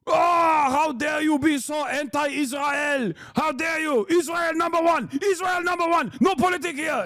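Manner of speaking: high, hammed-up accented ranting; mock outrage. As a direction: parody an indignant zealot shouting slogans. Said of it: "Zion is getting mad at Miss Rachel".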